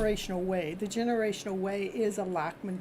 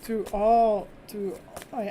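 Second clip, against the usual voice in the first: usual speaking voice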